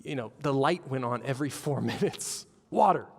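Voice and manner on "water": loud, high one-word order; rude demand. Retold or imitated. Rude passenger ordering the flight attendant, loud demand. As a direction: imitate a curt, demanding passenger.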